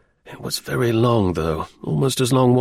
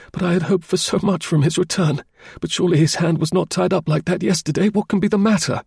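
put-on lightly raised, fretful young gentleman; a hint of worried Phelps, voice pitched up; worried client, lightly voiced higher; anxious Phelps; 6 semitones higher than usual. A narrator's reading.

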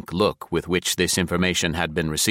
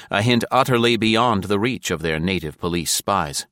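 ordinary delivery

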